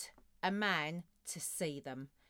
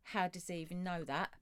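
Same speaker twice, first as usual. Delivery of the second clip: 轻声惊讶; 3 semitones lower, 8 dB quieter than usual. Acted. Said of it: "where they go".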